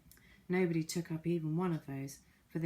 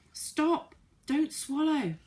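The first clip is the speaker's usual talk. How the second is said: frantic yell; 10 semitones higher, 7 dB louder, much slower than usual. Acted. Moonface still frantically yelling.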